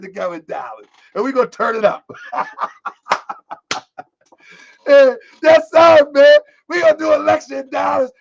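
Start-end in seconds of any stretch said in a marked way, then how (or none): 2.1-4.0 real high squealing laugh; excited airy cackle. Real, not acted. Laughing at own announcement. 4.9-8.1 louder, higher, hyped; real pumped-up shouting; hyped announcement; worked up. Real, not acted. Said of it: "I'm getting worked up".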